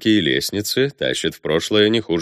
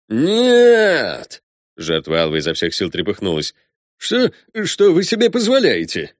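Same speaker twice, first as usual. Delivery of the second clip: high, loud, outraged yelling; panicked shout; 5 semitones higher, 4 dB louder than usual. Acted. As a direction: protest at the top of your voice. Victim struggling against Alva.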